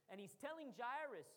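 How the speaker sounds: ordinary talk, nothing marked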